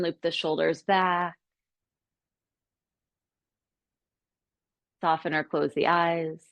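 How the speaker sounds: calm, unhurried settling-in guidance with long pauses; guided meditation. Acted. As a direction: guide them gently into stillness, leaving space between phrases.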